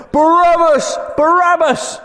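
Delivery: high, loud chant of a name; crowd shouting. Retold or imitated. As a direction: call it out like an angry crowd. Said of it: "The crowd went".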